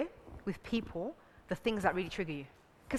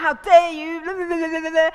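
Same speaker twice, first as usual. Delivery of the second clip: loud, high mock indignation; worked-up outrage; 6 semitones higher, 12 dB louder than usual. Woman fuming at unanswered phone, worked-up outrage. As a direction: rant it, tumbling into blahs. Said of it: "I get worked up".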